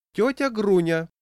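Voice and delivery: high, loud summons; calling out. A narrator's reading. Manageress summons the old woman, calling out. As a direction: call her over from across the hall. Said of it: "окликнула заведующая старушку".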